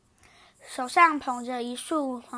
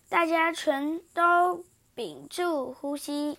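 plain reading voice

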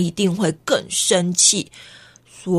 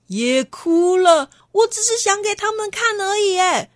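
put-on whiny boy; 10 semitones higher than usual. Boy complaining after crying.

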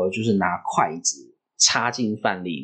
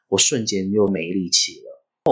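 normal talking voice

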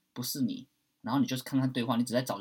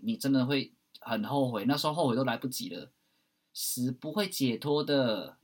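normal talking voice

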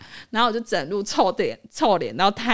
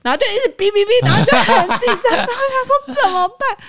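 high, loud, laughing retelling of the beeping; giggling mock panic; 13 semitones higher, 8 dB louder than usual. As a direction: laugh through the panic, pitched way up.

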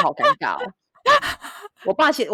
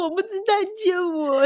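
much higher, imitated whimpering plea; mock crying plea; 12 semitones higher than usual. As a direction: whine it like a fake crying victim. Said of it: "就哭这样".

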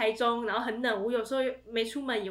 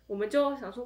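ordinary talk, nothing marked

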